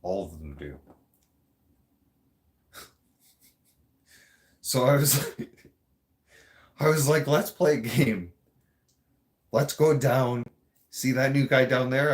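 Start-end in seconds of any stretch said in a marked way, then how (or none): 4.6-12.1 giggly plotting. Real, not acted. Laughing while retelling the plan.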